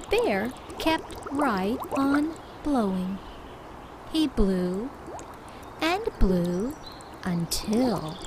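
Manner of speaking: gentle picture-book narration; reading to children. A narrator's reading. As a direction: read it like bedtime to little ones.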